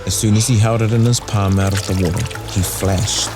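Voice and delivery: usual speaking voice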